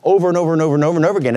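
fast rolling over and over; rapid pile-up. Acted. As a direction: rattle off the repeats at speed. Rapid piling repetition.

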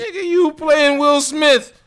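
high, loud, drawn-out rapper impression. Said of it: "That's my [name] voice".

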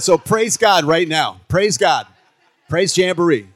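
loud, high, drawn-out praise call; exultant praise shout. Retold or imitated. Retelling his praise to Eddie, exultant shout. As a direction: shout it out like a revival cheer.

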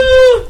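very high loud ooh; high squeal buzz-in. Pretend. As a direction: squeal it like a game buzzer. Making her buzz-in noise.

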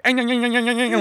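high, rapid nonsense noises; repeated crude imitation noises; mocking grunts. Host clowning on stage, mocking grunts.

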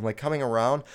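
ordinary talk

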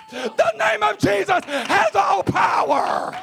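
high, loud revival shout; full-force preaching. Real, not acted. Preacher proclaiming the name to his congregation, impassioned high shouting. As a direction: drive each phrase home, stretching the climactic words.

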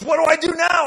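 high, loud eager ask; eager question. Retold or imitated. George asks the preacher what next, high eager shout. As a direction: blurt it out, keen to act. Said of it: "And he goes".

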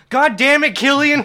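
high, very loud exasperated shout; full-volume imitated teacher's yell. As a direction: bellow it like a teacher at a pupil. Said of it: "the teacher just yelled".

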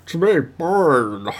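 slow, high villain voice; Bane voice. Pretend. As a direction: loom like a masked comic-book villain. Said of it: "like, Batman villain voices".

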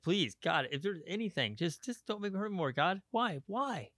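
high, pleading plea to God, retold; desperate prayer. Alone in bed at midnight, pleading upward in a high voice. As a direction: beg God to stop the hurting. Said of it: "You're just like".

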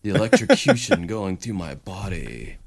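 a hint of an eager weirdo; mock weirdo voice. Killer voiced as wanting the chair.

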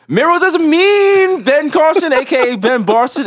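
high, slow, playful build-up; excited mock announcement. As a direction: drag it out like a teasing hype man. Mocking the candidate's name.

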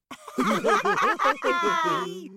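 high, rolling laughter; chortling. A narrator's reading. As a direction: laugh along with the whole hall. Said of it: "were chortling away".

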